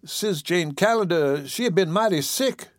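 high-pitched caretaker's dialect voice; Aunt Dicey Fairfax. Acted. Caretaker breaking in about the patient's sickness, interjecting. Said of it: "broke in Aunt Dicey Fairfax".

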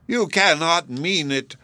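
understated cry of disbelief; a touch of shocked protest; mild mock shriek. A narrator's reading. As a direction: raise the voice a little, a muted narrated shriek. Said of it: "shrieked Hepzibah".

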